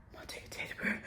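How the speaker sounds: whisper. Real, not acted. Telling viewers her dentist plan, whispering. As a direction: confide it in a hushed whisper.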